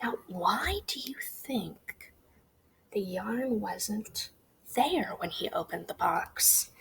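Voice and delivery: slow hushed whisper. Real, not acted. Teacher whispering a question to the class. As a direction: draw it out in a whisper. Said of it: "teacher whispers question slowly".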